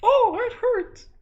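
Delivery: very high, loud, slow whine; hammed-up squeaky hurt; pained squeal. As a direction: squeal in mock pain. Son pricked by needle.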